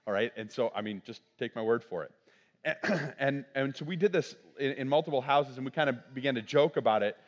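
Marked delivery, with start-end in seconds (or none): none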